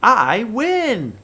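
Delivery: high, loud victory shout; excited. Shown as a demonstration. Teacher shows kids how a winner sounds, excited. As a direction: crow about winning. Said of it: "pretty excited sentence".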